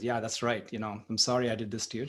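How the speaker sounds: sheepish apology. Retold or imitated. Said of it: "the guy feels really bad".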